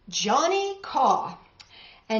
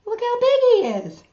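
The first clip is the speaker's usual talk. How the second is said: amazed; 13 semitones higher, 7 dB louder than usual. Real, not acted. Marveling at picture.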